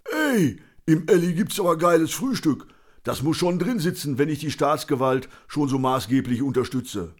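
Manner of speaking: high, loud put-on drinker voice; cocky big-mouth character; cantankerous Conny, cheeky and eager. A narrator's reading.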